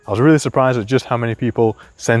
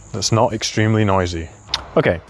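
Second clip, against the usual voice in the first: ordinary talk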